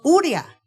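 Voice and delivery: "uria", the sloppy spoken form; common mispronunciation. Shown as a demonstration. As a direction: say "uria" the everyday way, E sounding as I. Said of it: "porque nosotros decimos".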